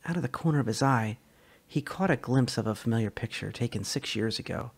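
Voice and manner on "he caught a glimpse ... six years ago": ordinary delivery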